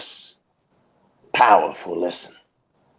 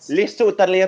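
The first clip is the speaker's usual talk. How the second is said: mock Italian; 11 semitones higher, faster than usual.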